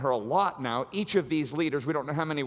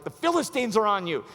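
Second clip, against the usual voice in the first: mock alarm shout; 6 semitones higher, 5 dB louder than usual. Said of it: "then she's like".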